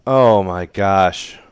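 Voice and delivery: very slow, drawn-out loud "oh my gosh"; exasperated. Real, not acted. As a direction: stretch it out in disbelief. Exasperated at bad darts.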